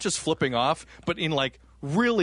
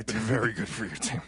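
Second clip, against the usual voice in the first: put-on old man voice; 4 semitones lower, 5 dB quieter than usual. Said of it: "my old man impression".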